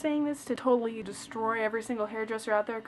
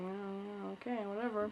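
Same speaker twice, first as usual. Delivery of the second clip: much softer, dismissive; retold unimpressed; 2 semitones lower, 11 dB quieter than usual. Humoring the chatty hairdresser, much softer and dismissive. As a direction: brush it off under your breath. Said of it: "I'm like".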